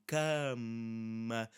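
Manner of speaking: cama stretched slowly with a held M; cama, lingering into a long "mmm"; altered A before M. Shown as a demonstration. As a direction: draw out cama to show the A before M. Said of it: "change how that sound is going to be produced".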